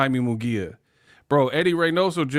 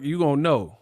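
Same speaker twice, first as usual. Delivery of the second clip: ordinary talk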